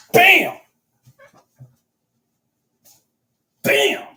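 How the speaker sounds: very high, loud shout; triumphant shout. Real, not acted. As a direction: shout in victory. Trade hits target.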